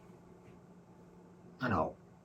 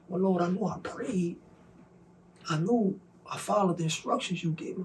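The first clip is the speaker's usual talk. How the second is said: bewildered prayer; 9 semitones higher, 4 dB quieter than usual. Retold or imitated. Pleading with God, confused.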